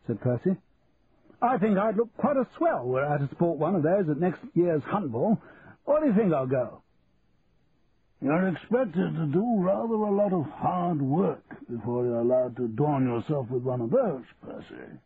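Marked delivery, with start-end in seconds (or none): 1.4-6.8 higher-pitched aristocratic musing; upper-class Percy, enthusiastic. Acted. 8.2-15.0 the King, gently reproving. Acted.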